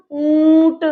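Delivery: "ऊंट" with a long nasalised ऊं; nose and mouth. Shown as a demonstration. Teacher gives a chandrabindu word, held nasal vowel in "ऊंट". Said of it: "नाक और मुंह दोनों में जोर पड़ेगा".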